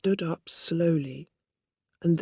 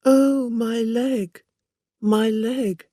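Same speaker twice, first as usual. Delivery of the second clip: slow, higher fake wail over the leg; mock cry of pain; 7 semitones higher, 6 dB louder, slower than usual. A narrator's reading. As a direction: ham up a fake injury cry. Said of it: "she's going to cry out".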